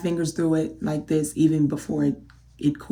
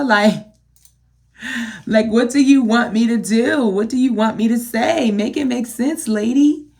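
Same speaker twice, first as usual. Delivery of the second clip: normal talking voice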